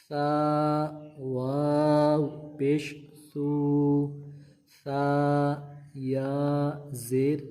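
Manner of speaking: stretched syllables with a soft s; سا سو سی drawn out gently; soft s drill. Shown as a demonstration. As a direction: chant the s syllables softly. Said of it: "نرمی کے ساتھ پڑھنا ہے".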